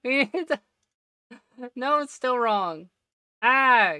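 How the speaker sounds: loud high laughter through words; laughing. Real, not acted. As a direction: crack up while talking. Laughs at own mistake.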